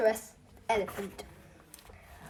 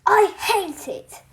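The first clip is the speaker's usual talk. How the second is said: huffed; 6 semitones higher, slower than usual. Acted. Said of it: "huffed the boy".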